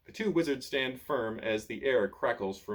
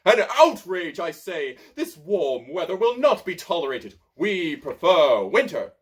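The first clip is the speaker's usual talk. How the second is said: much louder, higher, indignant; outraged; 5 semitones higher, 10 dB louder than usual. A narrator's reading. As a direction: bluster with pompous outrage. Wizard outraged by warm weather.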